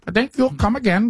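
put-on copying the Indian accent line; Indian accent attempt. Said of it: "with a very thick Indian accent".

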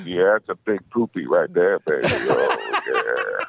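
sultry Love Master voice sinking very low; mock seducer. Diaper master dad coos over a poopy, mock-seductive. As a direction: drop into a deep, drawn-out lover's croon.

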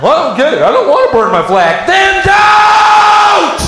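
loud, high shout; exasperated shout. Acted. Answering a confused patriot with an exasperated shout. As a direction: yell the obvious answer.

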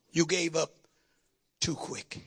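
hushed. Real, not acted. Preacher drops to a hush.

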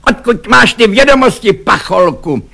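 high, loud indignant question; angry demand. A narrator's reading. Lieutenant interrogates the insolent soldier, high angry demand. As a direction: demand it as an outraged officer.

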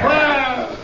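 high, desperate calls into the dark; frantic calling. Acted. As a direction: call her name out across the field, panicking. Calling her name again.

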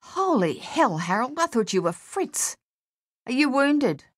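high-pitched, startled exclamation; shocked to find a mate; startled relief. A narrator's reading. Startled to find comrade, not enemy.

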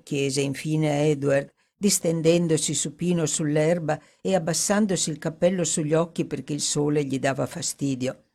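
usual speaking voice

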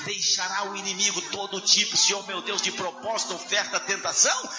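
fervent, half-sung prayer swelling to cries; closing prayer. Acted. Pastor praying aloud over the standing congregation, fervent sung prayer. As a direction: pray over the church, rising in fervour.

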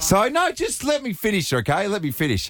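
high insistent let me finish; pleading protest. Real, not acted. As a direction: beg them to let you finish. Pleading to be heard out.

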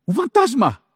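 high startled cry of fear; frightened cry. Acted. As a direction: shriek it like you've seen a ghost.

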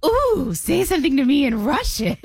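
high, laughing squeal of a flirty grandma. Retold or imitated. Granddaughter imitating grandma begging for Russian, laughing squeal. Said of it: "And she goes".

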